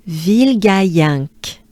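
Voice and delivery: Ville Gaillinck said slowly, stressing Ville; slow French Ville Gaillinck. Shown as a demonstration. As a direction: lead the group through Ville Gaillinck. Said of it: "let's try it together".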